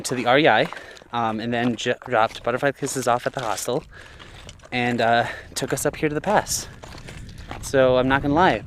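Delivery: normal talking voice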